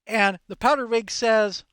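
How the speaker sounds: normal talking voice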